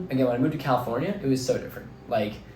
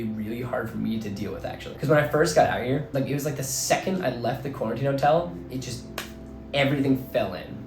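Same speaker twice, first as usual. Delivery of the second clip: ordinary talk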